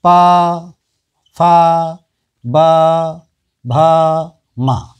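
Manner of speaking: पा, फा, बा, भा each held long on a level tone; lip-sound demonstration. Shown as a demonstration. Teacher showing sounds spoken with the lips, letter-by-letter demonstration. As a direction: sound out पा, फा, बा, भा singly, dropping on मा. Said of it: "जैसे ओठ से बोले जाने वाले जो होते हैं".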